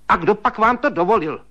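high, sharp scolding; stern. A narrator's reading. Sister-in-law scolds him for opening her cupboard, high and stern. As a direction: tell him off like a strict old maid. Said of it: "spustila přísně švagrová".

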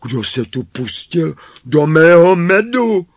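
high, loud indignant shout; loud dwarf outcry, pitched high; dwarf crying out. A narrator's reading. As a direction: cry out as an outraged little dwarf. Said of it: "vzkřikl čtvrtý".

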